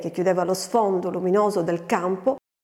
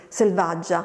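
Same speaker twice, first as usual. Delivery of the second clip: ordinary delivery, nothing marked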